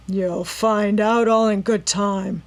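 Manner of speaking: loud, slow rough taunt; taunting villain. A narrator's reading. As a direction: taunt her with cold menace. Robber taunting Nancy.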